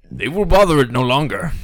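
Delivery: high, loud menacing vow; demon character. Acted.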